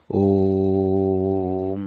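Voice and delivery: "Om" with a long held O and a short M; chant-like teacher demo of "Om"; long drawn Om. Shown as a demonstration. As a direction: hold the O of "Om", clip the M. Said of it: "O gets majority of time".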